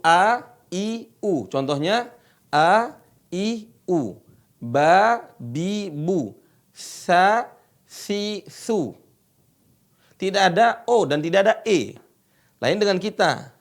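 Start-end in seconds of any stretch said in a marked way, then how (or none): none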